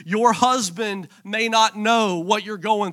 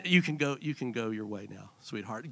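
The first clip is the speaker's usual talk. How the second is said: much softer and lower, gentle; father releasing his nervous daughter, softly; tender dismissal; 11 semitones lower, 11 dB quieter than usual. Real, not acted. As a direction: ease her off the stage lovingly. Sending nervous daughter off stage.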